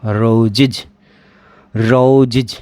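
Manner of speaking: रोजिज as roz-iz; teacher repeating रोजिज; pronunciation demo. Shown as a demonstration. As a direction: model रोजिज with iz ending. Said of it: "तो रोजिज".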